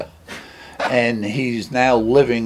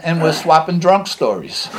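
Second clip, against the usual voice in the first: normal talking voice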